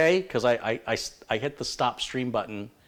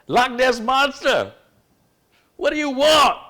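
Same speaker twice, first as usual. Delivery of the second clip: high-pitched, drawn-out holler at a monster; mock yell; 11 dB louder than usual. Telling a Loch Ness Monster joke, mock exasperated yell. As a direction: shout it loud and high, hamming it up.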